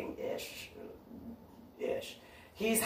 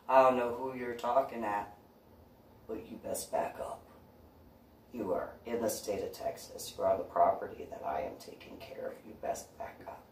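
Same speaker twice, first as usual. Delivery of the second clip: soft, menacing back-off warning; quiet threat, dropping toward a whisper; hushed menace telling him to back off; 7 dB quieter than usual. Retold or imitated. Warning off the intruder.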